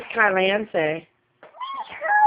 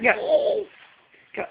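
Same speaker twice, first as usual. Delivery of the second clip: normal talking voice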